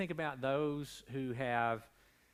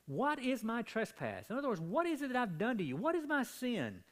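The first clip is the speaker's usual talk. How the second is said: raised, heated preacher voice, higher; Jacob's indignant outburst at Laban; Jacob lashing out; 8 semitones higher than usual. Retold or imitated. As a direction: lash out at Laban with rising heat. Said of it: "his voice intensifying as he's, he's lashing out".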